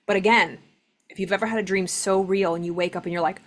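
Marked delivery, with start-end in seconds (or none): none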